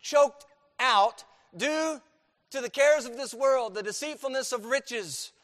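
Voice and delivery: loud, high preaching, hammering each phrase; full-force preaching. Acted. Pastor drives the point home to his church, loud full-force preaching. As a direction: preach it hard to the congregation. Preaching to congregation, rising intensity.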